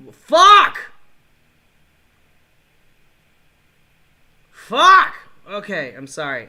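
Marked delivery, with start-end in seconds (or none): 0.1-5.1 much higher, much louder yell; furious scream. Real, not acted. Gamer furious at losing, screaming at the screen. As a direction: scream it out in rage. Raging at the game.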